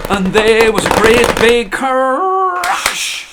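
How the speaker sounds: booming storybook climax; dramatic crash. A narrator's reading. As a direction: land the crash big and slow.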